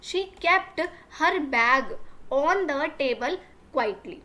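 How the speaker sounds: very slow, word by word for learners; slow classroom dictation reading; slow read-aloud. Shown as a demonstration. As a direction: spell the sentence out slowly for the class. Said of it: "teacher reads the English line".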